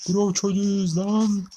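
slow, high mocking gangster impression; mock gang tough. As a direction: parrot the gang boast in a silly stretched voice.

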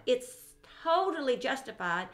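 ordinary talk

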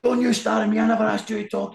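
imitating a screaming boss; retold shouting. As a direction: yell it like a furious manager. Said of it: "he's shouting at me".

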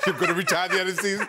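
quoting fast through laughter; laughing as he repeats the jibe; laughing quote. Retold or imitated. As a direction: rattle it off while cracking up. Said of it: "I could not stop laughing".

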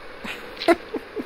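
squeaky airless laugh; high breathless laugh. Real, not acted. Laughing at yet another fall, high and breathless. After falling again.

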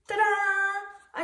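high, loud, drawn-out ta-da; proud fanfare. A narrator's reading. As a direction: sing out a triumphant reveal. Bob unveils his first sculpture.

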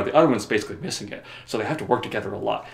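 normal talking voice

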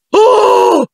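very high, sustained startled cry; high squeal. A narrator's reading. Lavanda Brown sees the creatures arrive, squealing. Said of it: "strillò Lavanda Brown".

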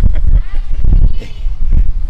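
a real, high burst of laughter; loud high laugh. Real, not acted. Husband jokes to the host about his wife's temper, bursting into loud laughter. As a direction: laugh hard at the thought of trouble at home.

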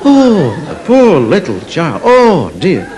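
slow, drawn-out horrified pity; pitying parents. Acted. Parents at the back seeing the poor child, pitying. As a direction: draw out each word in hammed-up dismay. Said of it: "parents at the back of the class are going".

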